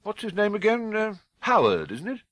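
feigned casual. Acted. Dursley feigning vague recall.

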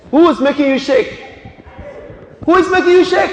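high, loud demanding question; insistent shout. Real, not acted. Preacher presses a church member to name someone, insistent shout. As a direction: fire the question out, demanding an answer.

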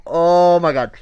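loud, high retold horror; big horrified outcry. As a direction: imitate two friends aghast at a scream. Retelling reaction to screaming wolf.

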